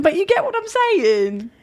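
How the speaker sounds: high, drawn-out mock Australian; badly faked Australian, slow and high; attempted Australian accent. Shown as a demonstration. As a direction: try on a shaky-footed Australian accent. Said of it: "if that's Australian".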